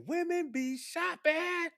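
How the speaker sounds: high, slow put-on comic voice; mock comedian voice, high and slow; comedian impression. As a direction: ham up the old stand-up bit, drawn out and way up high. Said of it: "He said".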